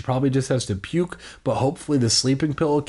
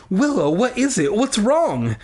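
demanding mother; 8 semitones higher than usual. A narrator's reading. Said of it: "demanded her mother".

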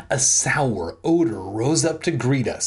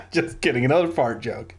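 ordinary delivery

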